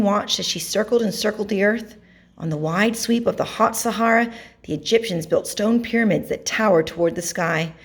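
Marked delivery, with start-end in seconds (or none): none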